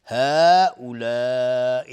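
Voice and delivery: هؤلاء chanted with both long vowels stretched; elongated madd. Shown as a demonstration. Teacher demonstrating the madd to students, slow and loud. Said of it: "اینجا دو نوع مد است".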